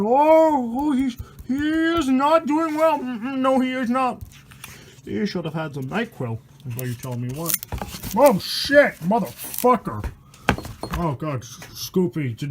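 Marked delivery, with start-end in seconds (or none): 0.0-4.2 high, slow, cooing mock sympathy; mock pity. 8.2-10.1 sudden loud outburst of shock, then a slow drawn-out curse; startled curse. Real, not acted. Startled by scary picture.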